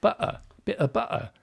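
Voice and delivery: high English-accent "butter" with the t swallowed; English glottal stop. Shown as a demonstration. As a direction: stop the throat in the middle of "butter", like an English guy. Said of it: "That's a glottal stop".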